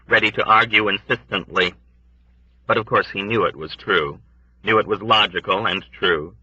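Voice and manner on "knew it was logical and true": plain reading voice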